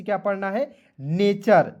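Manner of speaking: ordinary talk, nothing marked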